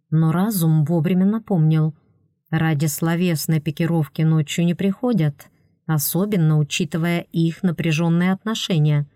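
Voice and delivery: ordinary delivery, nothing marked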